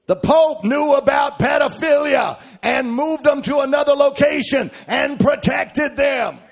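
high, forceful sermon shout; loud, drawn-out preacher's outcry; full-force preaching. Real, not acted. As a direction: thunder it out as a denunciation.

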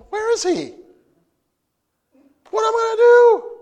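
high, loud, panicked wife; a wife panicking in the night, high and loud. Retold or imitated. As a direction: cry out in mock panic, voice flying up. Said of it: "she's going".

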